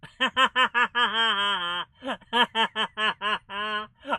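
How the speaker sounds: long gleeful cackling laugh; loud laughter. Real, not acted.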